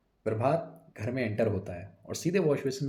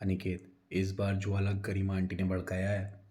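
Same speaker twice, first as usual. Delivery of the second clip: softer, hushed reply; low voice; 2 semitones lower, 7 dB quieter than usual. A narrator's reading. Son secretly answering his father, hushed. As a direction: answer under your breath. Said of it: "बहुत धीमी आवाज़ में जवाब देता है".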